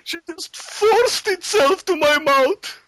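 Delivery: high, slow hammed-up whine; mock Boris lament. Boris telling the TV host, high and whining. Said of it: "Boris on TV".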